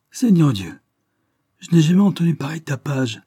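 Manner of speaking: high, loud mock-innocent outcry; innocent exclamation. A narrator's reading. Tiffle walks in on the scuffle, innocent exclamation. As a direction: exclaim as if you knew nothing. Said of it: "s'écria-t-elle d'un air innocent".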